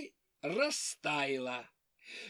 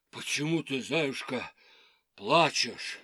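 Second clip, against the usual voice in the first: low bear voice; 7 semitones lower than usual. Acted. Old bear asks.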